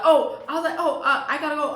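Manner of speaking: startled oh. Retold or imitated. Startled by mom's call.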